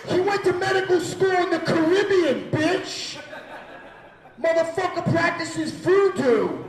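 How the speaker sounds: high, very loud Brooklyn Italian yelling; Brooklyn mobster cousins. Retold or imitated. Brooklyn cousins sticking up for him, outraged hollering. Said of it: "my Brooklyn Guido mobster Italian cousins".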